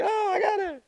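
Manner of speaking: high, lightly put-on "oh, I got it"; mock listener getting it. Preacher voicing listeners who catch the reference, high mock voice. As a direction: play the listener who suddenly gets it. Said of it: "Others, you're like".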